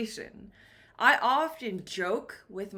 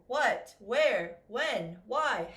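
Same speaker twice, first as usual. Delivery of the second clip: ordinary talk, nothing marked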